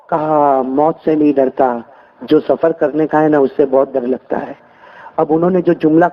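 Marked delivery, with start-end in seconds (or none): 0.6-4.5 much lower, slow admission; dying father explaining his fear to his daughter; old father. Acted.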